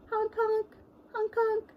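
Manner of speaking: high honking clown noise; silly clown. Pretend. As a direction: honk like a goofy clown. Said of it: "give a big honk".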